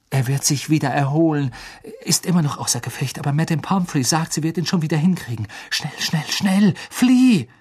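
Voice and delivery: fast, building to a shout; hurried urging. A narrator's reading. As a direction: rush him off. Rushing reply, urging flight.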